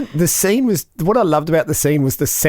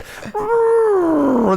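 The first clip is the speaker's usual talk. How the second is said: whale noise. Retold or imitated. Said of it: "someone's just gone".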